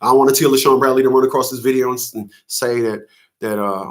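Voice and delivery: ordinary delivery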